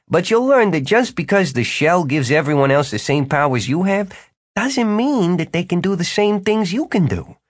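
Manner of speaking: high, animated character pitch; Jimmy, persuasive wizard. A narrator's reading. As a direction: lift the voice high and sell it with flattery. Jimmy flatters and recruits Martin.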